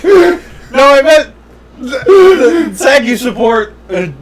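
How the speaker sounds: high, halting words through laughter; helplessly amused, struggling to finish; laughing protest. Real, not acted. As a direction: object while cracking up. Protesting while cracking up.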